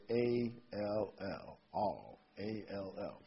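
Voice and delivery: "A L L" spelled letter by letter, slowly and quietly; slow spelling of "A L L" for emphasis. Shown as a demonstration. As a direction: spell "A L L" out slowly, then say "all". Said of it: "I wanna emphasize that little word".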